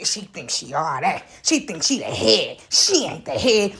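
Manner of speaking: low, slow mocking whisper of a jealous rival; jealous gossip mimicked. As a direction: sneer it low and slow behind her back. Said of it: "they be in the back".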